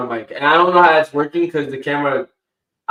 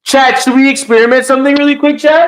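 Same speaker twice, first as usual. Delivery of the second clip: excited call out; 10 semitones higher, 7 dB louder than usual. Real, not acted. Eager call to chat.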